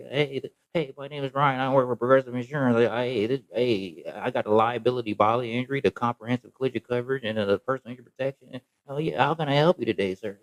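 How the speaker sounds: mock chirpy insurance agent greeting a caller; customer service voice. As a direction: put on the eager call-center rep. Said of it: "that customer service voice".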